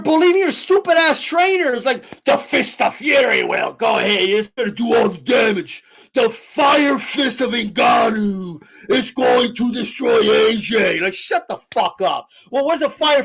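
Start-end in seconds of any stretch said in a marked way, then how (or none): 2.2-11.0 slow, drawn-out pompous proclamation; mock grandiose trainer. Streamer mocking stupid trainers hyping Ngannou, mock grandiose. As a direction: intone it like an overblown prophecy.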